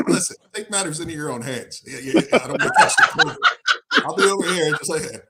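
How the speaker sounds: high laughing wife voice. Retold or imitated. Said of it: "She's telling me".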